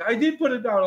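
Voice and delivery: high, upset protest, imitated; mock bawling. Man retelling how he answered his best friend, mock tearful. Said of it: "I was bawling my eyes out".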